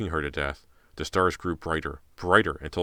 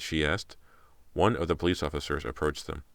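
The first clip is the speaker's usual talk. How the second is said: usual speaking voice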